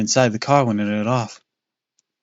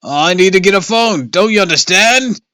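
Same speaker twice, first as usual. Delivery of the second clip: desperate shout; 9 semitones higher, 8 dB louder than usual. Acted.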